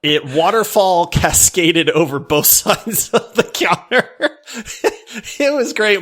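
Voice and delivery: laughing through the story; giggling as it spills out. Real, not acted.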